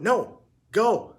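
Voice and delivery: high, loud shooing yell. A narrator's reading. Heidi yelling at the little dog to leave, high and loud. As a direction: shout the dog away. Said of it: "she yelled".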